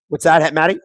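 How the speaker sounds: ordinary talk, nothing marked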